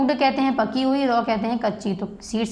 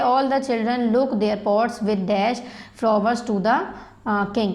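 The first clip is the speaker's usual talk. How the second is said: very slow, word by word, for students to write; slow dictation; much slower than usual. Shown as a demonstration. Teacher reading a worksheet line to the class, slow dictation. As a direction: read it out slowly so the class can copy.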